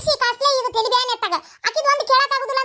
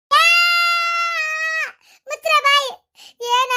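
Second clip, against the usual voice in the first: angry shout; 5 semitones higher than usual. Acted. Mother-in-law silences the quarrel.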